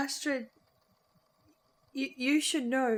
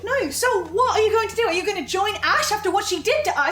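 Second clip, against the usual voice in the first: raised, high and loud; alarmed confrontation; 7 semitones higher, 6 dB louder than usual. Acted. Confronting Percy about joining Ash, loud and alarmed. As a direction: demand answers, voice climbing.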